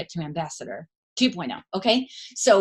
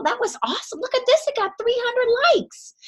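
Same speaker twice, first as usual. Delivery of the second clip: excited praise. Retold or imitated. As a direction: gush over the post like it's huge news. Coach praising a post on video.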